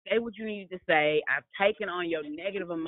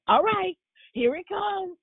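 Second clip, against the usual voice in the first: bracing son; 12 semitones higher, 4 dB louder than usual. Retold or imitated. Son bracing for his brother.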